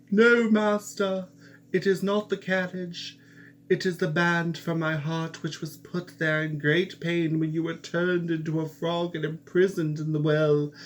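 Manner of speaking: high, loud, impassioned servant; loud servant's reply. A narrator's reading. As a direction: call out to reassure the master. Henry answers the prince.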